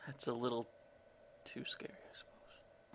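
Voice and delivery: very soft, almost whispered; quiet aside. Real, not acted. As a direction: drop to a near whisper after the outburst. Sheepish aside after screamed take.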